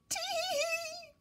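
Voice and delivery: high, slow, put-on childish tee-hee giggle; child's giggle. Narrator voicing a giggling child in the theme park, high tee-hee giggle. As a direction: giggle like a delighted small child. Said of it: "a child was giggling".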